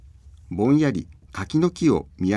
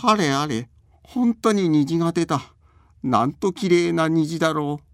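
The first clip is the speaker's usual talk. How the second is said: put-on amazed old man voice; 7 semitones higher than usual. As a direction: marvel like a delighted grandpa. Old man marvels at rainbow.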